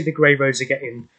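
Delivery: ordinary talk, nothing marked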